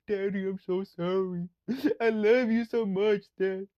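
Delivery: high, slow, sugary apology; mock a sorry little girl; sweet apologetic daughter. Daughter apologizing to dad, high and slow. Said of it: "be like".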